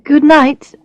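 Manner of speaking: forced good night. Acted. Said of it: "I reply".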